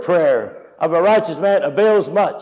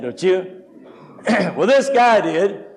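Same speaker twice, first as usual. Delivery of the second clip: ordinary talk, nothing marked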